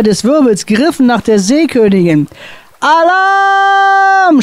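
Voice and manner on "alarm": desperate shout. A narrator's reading. Captain screams alarm to his crew. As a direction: yell it out in desperate need. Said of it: "schrie Captain Gorch in höchster Not".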